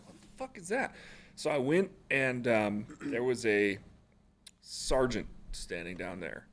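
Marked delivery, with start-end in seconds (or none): 0.1-0.9 baffled exclamation. Retold or imitated. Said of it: "I was like".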